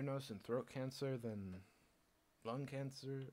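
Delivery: ordinary delivery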